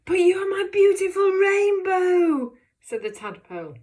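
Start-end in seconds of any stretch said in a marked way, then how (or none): 0.1-2.6 high, loud, tearful plea; desperate pleading cry. Shown as a demonstration. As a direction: beg her with a wail in the voice. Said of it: "the tadpole pleads with her".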